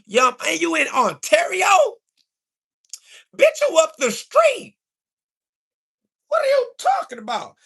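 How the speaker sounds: high-pitched shouted disbelief. Real, not acted. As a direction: yell it at him, incredulous. Shouting at chatter in Ontario.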